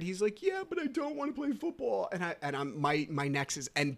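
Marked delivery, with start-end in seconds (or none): 0.4-2.1 put-on high-pitched little boy pleading; whining child. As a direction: imitate a kid who wants out. Voicing his reluctant young son.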